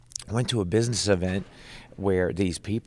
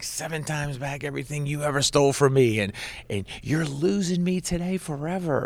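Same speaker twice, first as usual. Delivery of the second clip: high-pitched mock-screamed demand; retold screaming; 7 semitones higher, 4 dB louder than usual. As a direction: re-enact a mad, screamed ultimatum, pitch climbing. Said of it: "And I'm screaming".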